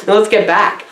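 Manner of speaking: usual speaking voice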